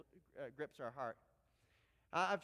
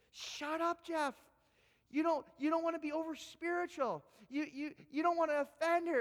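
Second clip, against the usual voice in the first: high, louder, taunting inner voice; mocking inner voice; 7 semitones higher, 4 dB louder than usual. Pastor voices the fear in his head, high and taunting. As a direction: sneer like the doubting voice in your head. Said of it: "this other voice in my head says".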